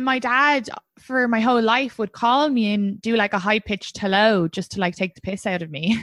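ordinary talk, nothing marked

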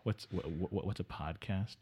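baffled muttering guest; Delaware Dave. Acted. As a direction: stammer it out, utterly lost. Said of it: "Delaware Dave confused".

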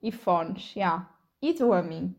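ordinary talk

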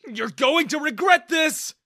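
high, furious shout; yelling. A narrator's reading. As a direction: yell a threat at the manager. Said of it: "I yelled at Daniel".

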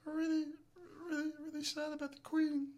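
tiny, squeaky-high hushed celebrity impression.

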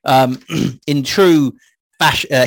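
normal talking voice

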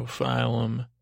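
usual speaking voice

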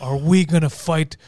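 low, grave put-on voice; serious gang leader. Leader calls a serious gang vote, grave.

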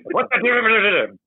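high-pitched imitation of an argument; mock yelling. Writer mimicking a couple yelling at each other, high mock yell. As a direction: imitate a drawn-out yell from behind a door. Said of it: "yelling at each other".

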